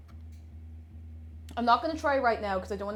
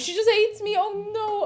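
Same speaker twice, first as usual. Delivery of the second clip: high, louder self-pity; mock wounded; 10 semitones higher, 5 dB louder than usual. Real, not acted. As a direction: play hurt at being ignored. Device rejects her.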